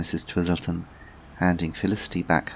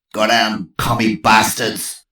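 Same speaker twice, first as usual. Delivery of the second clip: slow, louder American growl; growling general; 4 semitones higher, 9 dB louder, slower than usual. Acted. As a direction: snarl like an old general. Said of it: "growled the American general".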